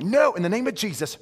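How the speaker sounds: mock rebuke. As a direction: rebuke the weed half in jest. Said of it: "I'm like".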